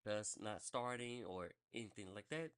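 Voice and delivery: normal talking voice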